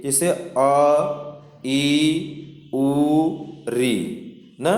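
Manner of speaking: each vowel sung out slowly as a teaching demo; short vowel demo. Shown as a demonstration. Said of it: "इसमें एक मात्राएं होती हैं".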